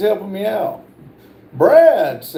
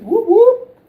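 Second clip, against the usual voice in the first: high, loud cheer; playful whooping; 5 dB louder than usual. Real, not acted. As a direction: whoop it up for the guest. Hyping up tonight's guest.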